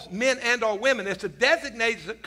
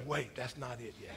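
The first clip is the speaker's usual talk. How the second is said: wife's quiet correction; 8 semitones lower, 13 dB quieter than usual. Retold or imitated. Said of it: "And Jody goes".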